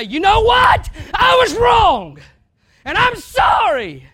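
high, loud, slow screamed confession; screamed apology. Pretend. Preacher screaming a mock apology at the church. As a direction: scream the apology at full force. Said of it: "Maybe not screaming like that".

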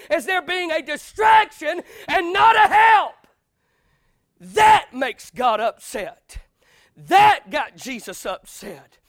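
rising to a booming, slowed preacher's shout; preaching at full force. Real, not acted. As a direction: build the fire until the last words ring out.

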